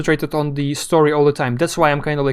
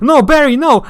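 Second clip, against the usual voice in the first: higher and louder, a hammed-up yell of despair; mock panicked shout; 10 semitones higher, 8 dB louder than usual. Alan yelling at Barry as the helicopter falls, mock shout. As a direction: ham it up like a game hero screaming for his friend. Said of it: "and he's like".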